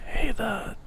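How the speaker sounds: hushed whisper, as if into an ear; soft, voiceless retold whisper; imitated whisper. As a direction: whisper it like a secret tip-off. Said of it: "she whispers in my ear".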